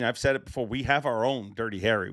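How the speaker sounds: usual speaking voice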